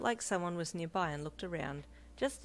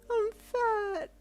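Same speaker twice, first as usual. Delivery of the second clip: high, loud tearful wail; wailing to her husband, crying; 8 dB louder than usual. Acted. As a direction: wail it out through tears. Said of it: "you wailed".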